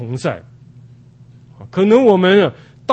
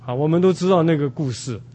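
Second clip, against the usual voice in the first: ordinary talk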